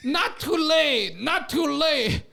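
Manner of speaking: high, slow Indian English teacher drill; teacher voice with a put-on Indian accent; mock Indian accent. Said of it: "the Indian teacher".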